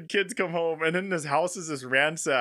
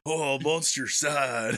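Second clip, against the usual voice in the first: low, slow, sad monster; mock monster voice; 6 semitones lower, slower than usual. Mimicking Keanu playing with his kids, sad monster voice. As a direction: imitate a dad's goofy pouting monster. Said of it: "he kept doing the voice".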